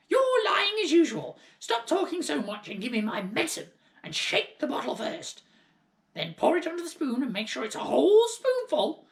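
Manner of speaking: put-on high, loud cranky old-woman orders; bossy grandmother. A narrator's reading. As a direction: bark orders like a grumpy old hag. Grandmother bossing George about medicine.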